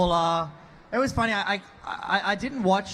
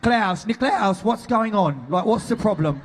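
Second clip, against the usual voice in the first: slow, louder mock London accent calling to Klaus; London street accent, louder; 3 semitones higher, 5 dB louder, slower than usual. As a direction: drag out Niklaus in a cheeky London accent. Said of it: "I should have done a different accent for Cole".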